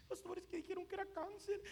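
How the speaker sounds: high, whimpering mock sob; acted anguished whine; tearful distress. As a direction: blurt the bad news as if crying. Acts distressed person with bad news.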